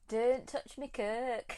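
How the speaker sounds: playful Hull impression; Hull accent. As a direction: exaggerate the Hull vowels in 'Kirk'. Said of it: "someone with a strong Hull accent".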